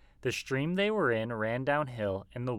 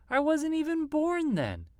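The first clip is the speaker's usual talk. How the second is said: high bleating lamb. A narrator's reading. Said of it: "bawled the lamb".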